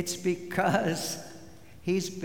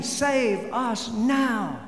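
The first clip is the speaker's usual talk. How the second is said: crowd's urgent cry; 6 semitones higher, much slower than usual. Retold or imitated. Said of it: "So the crowd is saying".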